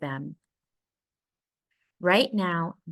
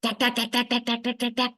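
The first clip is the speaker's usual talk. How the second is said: higher, hammering tack tack; jackhammer sound; 5 semitones higher, much faster than usual. A narrator's reading. Reading to a child, imitating the street jackhammer. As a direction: rattle it out like a jackhammer. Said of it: "It makes a loud banging sound".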